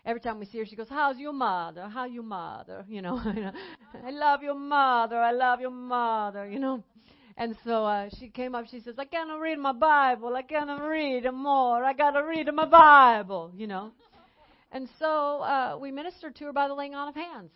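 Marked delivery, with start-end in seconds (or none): 0.9-2.9 lightly put-on Italian old lady accent; a fond hint of an Italian grandmother; Italian accent greeting. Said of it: "I can't talk about what she did without doing the accent". 4.1-6.5 put-on louder, fond Italian old lady accent; Italian accent, affectionate. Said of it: "without doing the accent". 9.0-13.5 put-on pleading Italian accent, getting louder; an Italian grandmother begging for help; Italian accent, rising plea. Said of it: "without doing the accent".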